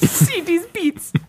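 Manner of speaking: high, slow hammy show-off. Pretend. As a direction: drag it out high and silly.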